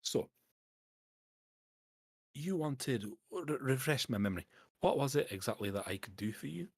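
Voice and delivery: lightly voiced Scottish dwarf; a hint of a Scots dwarf boss. Dwarf asks the adventurer what was wanted, lightly voiced Scottish dwarf.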